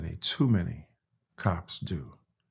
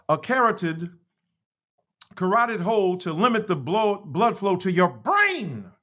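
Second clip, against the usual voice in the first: reading aloud; 5 semitones higher than usual. Acted. Reads his written piece aloud.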